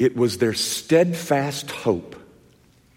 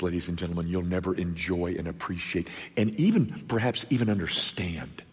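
ordinary delivery

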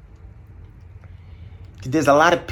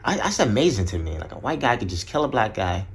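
ordinary talk, nothing marked

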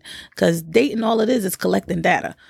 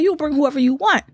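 higher-pitched retold male friend's voice; Courtney; 7 semitones higher than usual. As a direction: lightly voice the man granting permission. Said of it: "Now, this is Courtney talking".

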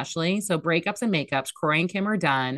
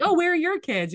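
high, nosy stranger's question; mock nosy stranger; 12 semitones higher than usual. Mocking fans who ask an older Swiftie about kids. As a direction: play a curious stranger at the concert.

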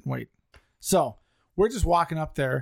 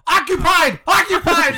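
high, loud laughing yell; frantic shout; 13 semitones higher, 9 dB louder than usual. Retold or imitated. Teen yelling at a stranger who unlocks his stall door. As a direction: shout it to warn the intruder. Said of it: "And I'm like".

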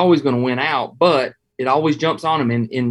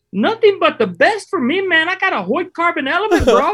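very high surfer-dude voice, laid back; high-pitched surfer dude. Retold or imitated. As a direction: squeak it out like a mellow surfer guide. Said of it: "he's got a real high-pitched voice".

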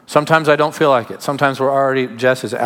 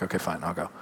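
"Okay, fine, I'll go" muttered much lower and softer, worn out; grudging mumble; 8 semitones lower, 15 dB quieter, much faster than usual. Retold or imitated. Tired pastor agreeing to a late reconciliation meeting, reluctant mumble. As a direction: sigh it out like you'd rather be in bed.

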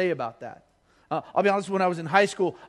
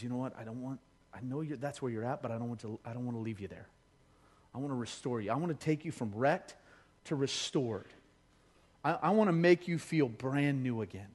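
gentle God voice; 5 semitones lower, 6 dB quieter than usual. Acted.

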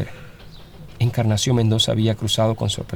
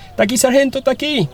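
put-on high-pitched child's cry to alert someone; boy's shout; 3 dB louder than usual. Frightened boy alerting the sergeant to the fugitive, shouting high. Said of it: "gritó señalando hasta el punto".

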